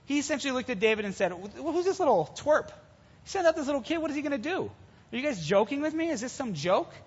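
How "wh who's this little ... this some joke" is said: high-pitched incredulous questions; acted indignation, pitched up in disbelief; scornful disbelief. Scornful Goliath voiced looking at David.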